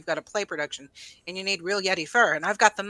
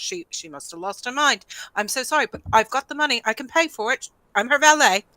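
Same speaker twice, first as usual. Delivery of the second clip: flustered valet with a put-on accent, pleading earnestly; apologetic valet in an accent; 4 dB louder than usual. Valet apologizing to shopkeeper for his lady, flustered put-on accent. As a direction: gush apologies, stumbling over words to sound genuine. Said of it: "I did it in an accent, and I, I sounded truly genuine".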